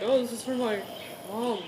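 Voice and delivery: ordinary delivery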